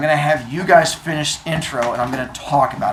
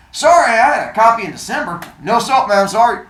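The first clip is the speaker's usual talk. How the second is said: quick, louder brush-off; dismissive bookseller; 8 semitones higher, 6 dB louder than usual. Acted. Bookseller on the phone brushing off the caller, quick and loud. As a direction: rattle off the refusal fast.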